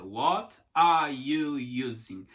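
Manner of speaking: slow, each word apart; separated words. Shown as a demonstration. Teacher imitating the slow separated way, pulled apart. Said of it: "ouvir separadinho".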